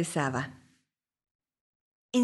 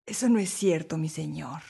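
lightly voiced rival woman, lower; a touch lower, cool denial; other woman denying; 6 semitones lower, 3 dB quieter than usual. A narrator's reading. Other woman answers the accusation, mildly defiant. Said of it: "replicó la otra mujer".

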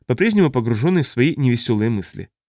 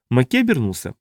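ordinary delivery, nothing marked

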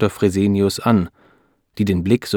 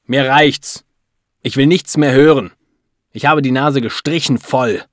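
loud, raised, fed-up outburst; angry interruption; 5 semitones higher, 5 dB louder than usual. A narrator's reading. Husband refusing to hear the doctor out, angry. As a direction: shut her down, at the end of your tether. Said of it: "lässt die Ärztin nicht zu Wort kommen".

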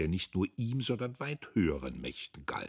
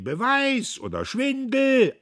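loud, high, slow demand; the others shout back; crowd shouting; 13 dB louder, slower than usual. A narrator's reading. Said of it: "Die anderen schrien".

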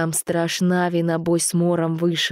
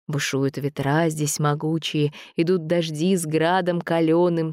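usual speaking voice

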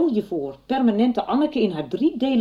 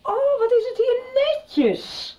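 slow, much higher sarcastic exclamation; mock surprise; slower than usual. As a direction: tease him about the mess. Mocking the tidy room. (spottend overdreven verbaasd)

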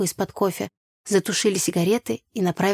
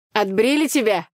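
mock sympathy; 3 semitones higher, 4 dB louder than usual. A narrator's reading. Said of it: "сочувственно поинтересовался брат".

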